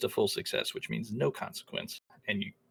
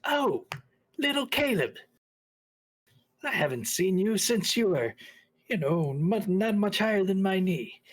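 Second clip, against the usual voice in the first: put-on high, doting elderly voice; a fond old family friend, much higher and gushing; doting old lady; 8 semitones higher, 4 dB louder than usual. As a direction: gush over him like a fond old auntie. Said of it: "She squints and she looks at you".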